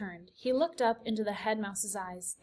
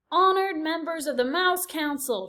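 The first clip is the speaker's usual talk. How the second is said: loud, higher formal announcement; shout; 7 semitones higher, 5 dB louder than usual. A narrator's reading. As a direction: proclaim to the council. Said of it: "shouted Furlow".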